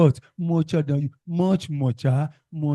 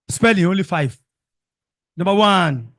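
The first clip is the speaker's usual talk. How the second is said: announcing; 3 semitones higher, 5 dB louder than usual. Real, not acted. Teacher announcing spelling test.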